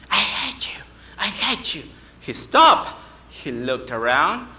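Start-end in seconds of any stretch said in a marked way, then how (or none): 0.1-1.8 put-on higher, distant echo of a boy's yell. Unseen young boy yelling back to him, muted echoing call. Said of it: "a voice of a young boy yelling back to him".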